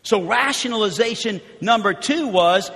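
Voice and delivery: ordinary delivery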